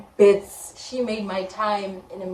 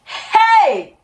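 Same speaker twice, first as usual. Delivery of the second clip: sudden high shocked cry; alarmed cry; 13 semitones higher, 9 dB louder than usual. Real, not acted. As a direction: burst out in alarm. Panic on hearing the news.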